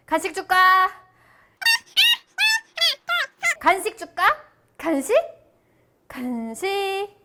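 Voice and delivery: high coaxing treat voice; talking to a dog. Real, not acted.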